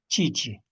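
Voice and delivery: chichi, clear ch sounds, falling tune; teacher repeats chichi for the class; modeling chichi. Shown as a demonstration. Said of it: "Así decimos".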